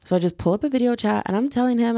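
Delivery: normal talking voice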